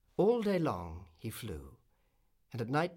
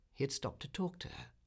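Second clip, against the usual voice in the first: plain reading voice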